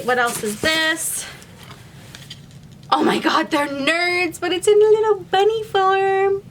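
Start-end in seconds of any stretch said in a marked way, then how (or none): 2.9-6.4 high voice, climbing higher; real giddy discovery; excited delight. Real, not acted. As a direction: gush over it, rising to a squeal. Delighted at candy find.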